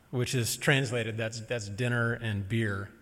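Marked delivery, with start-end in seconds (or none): none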